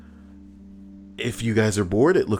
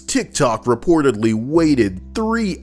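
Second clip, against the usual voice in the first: news read; 8 semitones higher, 4 dB louder, slower than usual. Acted. Reads a news item.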